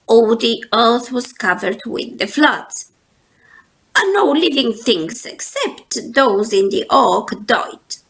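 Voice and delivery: ordinary delivery, nothing marked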